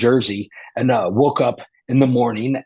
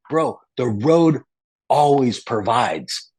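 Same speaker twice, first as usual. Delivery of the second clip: slow wise motto; slower than usual. Retold or imitated. Friend's wise motto retold.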